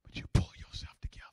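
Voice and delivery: quick whisper after an outburst; hushed recovery. Acted. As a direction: whisper it fast, pulling it back together.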